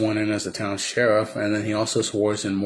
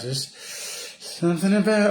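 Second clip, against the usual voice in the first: much higher, slow, swooning wife; dreamy swoon; 10 semitones higher, slower than usual. Retold or imitated. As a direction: sigh it out like a smitten wife. Wife swooning over Johnny Ringo.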